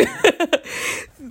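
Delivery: high laugh. Real, not acted. Laughing at herself.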